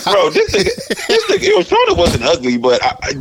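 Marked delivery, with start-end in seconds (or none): 0.0-2.0 laughing through the words; cracking up at the joke. Real, not acted. Caught out by a friend's joke, laughing.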